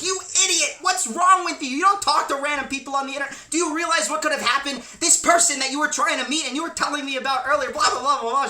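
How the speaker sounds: high, rapid mock tirade; imitated yelling mom. Mom yelling at son about talking to strangers, mock-furious. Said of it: "she just starts yelling at this".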